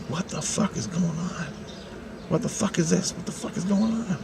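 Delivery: quietly baffled muttering to yourself; hushed bewilderment. Retold or imitated. Noticing the two men behind newspapers, hushed bewilderment. Bewildered aside to himself in story.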